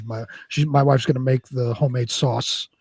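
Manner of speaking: ordinary delivery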